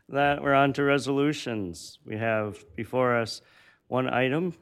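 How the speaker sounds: ordinary talk, nothing marked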